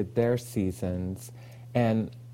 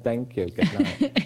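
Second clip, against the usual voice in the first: laughing sign-off; 3 semitones higher, slower than usual. Pretend. Mock sign-off after a joke.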